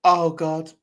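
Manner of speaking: put-on Jamaican-Welsh accent. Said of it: "I just got in my head".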